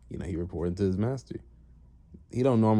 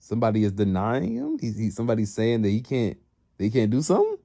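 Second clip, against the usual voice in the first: raised, incredulous questioning; perplexed; 4 semitones higher, 4 dB louder than usual. Retold or imitated. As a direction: act out a boss baffled at being refused. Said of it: "he kinda, he kinda perplexed".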